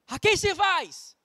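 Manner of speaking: very slow, weighty question; slow solemn question. Acted. Joshua challenging the people, slow and solemn. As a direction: let each word hang.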